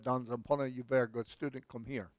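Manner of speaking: lightly voiced Indian teacher accent, calling the boy over; a hint of an Indian teacher's accent. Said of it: "Indian school teacher said".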